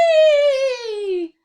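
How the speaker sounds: high, loud squeal of joy; gleeful whee. Acted. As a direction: squeal like a delighted pony. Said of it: "said Honey".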